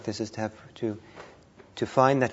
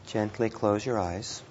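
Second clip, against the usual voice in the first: guided meditation; 3 dB quieter than usual. Real, not acted. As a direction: ease them gently through each breath, unhurried.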